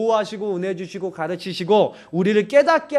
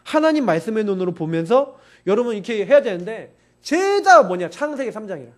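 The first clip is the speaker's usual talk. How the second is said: ordinary delivery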